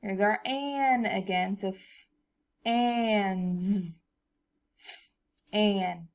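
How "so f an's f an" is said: sounds pulled apart; sounding out words. Shown as a demonstration. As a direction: sound it out slowly. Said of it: "let's do another one".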